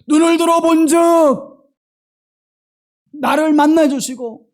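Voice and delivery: loud, high cry from the pulpit; full-force preaching. Real, not acted. Preacher testifying to God's protection, at full force. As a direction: build the sermon to a shouted climax.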